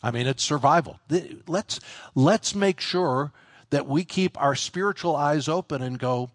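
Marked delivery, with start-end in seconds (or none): none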